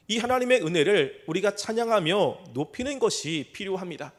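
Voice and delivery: ordinary talk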